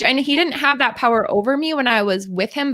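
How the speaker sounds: ordinary talk, nothing marked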